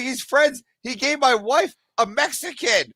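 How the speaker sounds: high, joking retort voice; sarcastic comeback; mock retort. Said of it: "I was like".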